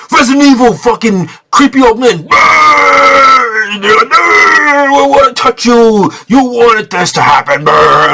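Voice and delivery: high, loud hammed-up zombie taunt; mocking the monsters, loud and high. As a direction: ham up a creepy ghoul voice.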